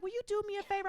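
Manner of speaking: high, soft mock-mom voice; pleading mom. Mom asks the stranger a favor, high and soft. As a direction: ask coyly in a high little voice.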